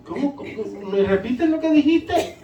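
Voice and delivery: acted high, hesitant question; puzzled listener. Foreigner asking a Puerto Rican to repeat, puzzled.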